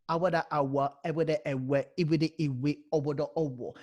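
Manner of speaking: Awada awa, ewede ewe, iwidi iwi, owodo owo run through evenly; vowel pattern drill. Shown as a demonstration. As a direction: chant the four vowel patterns in a row. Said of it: "Different kind of phonetical sound".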